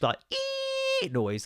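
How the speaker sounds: very high, slow, soft ee whine; thin kettle-whistle imitation; high-pitched whine. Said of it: "a proper high-pitched".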